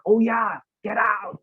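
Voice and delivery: lightly imitated burst of recognition; imitating sudden recall. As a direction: act out people remembering a catchphrase. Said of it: "it's still registered in many people's head".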